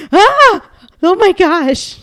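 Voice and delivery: high, loud thrilled gasp; excited squeal. Real, not acted. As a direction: squeal with delight. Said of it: "It's so exciting".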